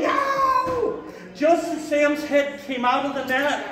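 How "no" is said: long, high-pitched "no"; movie-style drawn-out scream, played for laughs; retold scream. Storyteller diving through the air for the bird, mock scream. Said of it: "I'm screaming".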